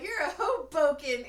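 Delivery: loud, high, laughing through the line; giggling mock jab; laughing insult. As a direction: crack up while throwing the insult. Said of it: "she's like".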